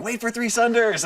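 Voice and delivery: high-pitched mock yell at raiders; retold yell. Raider yelling at people pulling aggro, mock yell. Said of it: "I'd be yelling at them".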